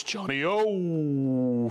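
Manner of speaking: slow, stretched JOHNNY O; rolling drawn-out name. Real, not acted. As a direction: let the O in Johnny O roll on and on. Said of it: "rolls off the tongue".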